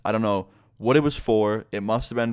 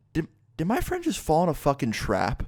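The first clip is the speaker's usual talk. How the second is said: high, stammered mock shock; acted disbelief; 6 semitones higher than usual. Teller realizing a friend fell in a trap, high incredulous voice. Startled reaction to friend's fall.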